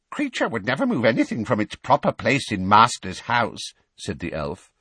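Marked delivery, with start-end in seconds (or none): 0.1-3.7 high, loud indignant denial; affronted house-elf, pitched up; protesting elf. Acted. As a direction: protest innocence in an elfish voice.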